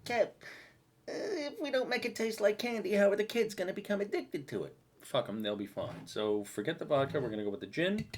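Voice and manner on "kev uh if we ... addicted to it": high, whiny imagined viewer; mock viewer voice. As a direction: whine it like a naive fan. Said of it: "I know what you're thinking".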